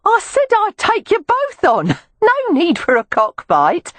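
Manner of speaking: very high Cockney screech; Cockney accent. A narrator's reading. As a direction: call out high in broad Cockney. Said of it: "called out in a Cockney accent".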